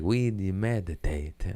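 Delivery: a bad Jamaican accent, half-mumbled; clumsy Jamaican imitation. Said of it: "I fucked up his accent".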